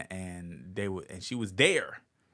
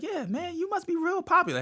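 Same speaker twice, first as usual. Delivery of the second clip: much higher mom voice, teasing; mom's voice. Retold or imitated. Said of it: "my mom be like".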